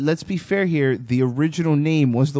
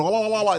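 higher, quick "la-la-la" chant; mock war whoop; 7 semitones higher, faster than usual. Host doubting the myth, mock war whoop. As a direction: imitate a whooping call in passing. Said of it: "I don't think they just went".

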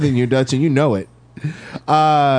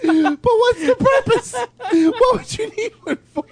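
much higher, squeaky with laughter; laughing. Real, not acted. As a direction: laugh through the question. Laughing, baffled question.

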